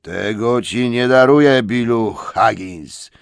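loud, slow threat; angry troll. Acted. Bert threatens William before punching, loud and slow. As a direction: growl it out slow. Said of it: "krzyknął Bert".

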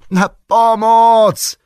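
high, loud, drawn-out shout; slow, stretched scream for help; desperate cry for help. A narrator's reading. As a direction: yell for rescue at full voice. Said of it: "krzyknęli Frodo i Sam".

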